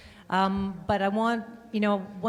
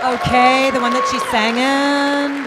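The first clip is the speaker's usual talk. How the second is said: excited; 6 semitones higher, 13 dB louder than usual. Real, not acted. Laughing loud remark.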